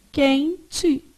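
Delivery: "Quente" in two parts, quen and te; syllable by syllable. Shown as a demonstration. Teacher shows how you say it, syllable by syllable. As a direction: leave a clear gap inside "Quente". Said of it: "você fala assim, ó".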